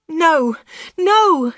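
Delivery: high, loud cry of refusal. A narrator's reading. Anna to Dave as he holds her, crying out. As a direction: cry out in alarm, pitched right up. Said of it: "she cried".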